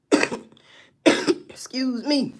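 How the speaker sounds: ordinary delivery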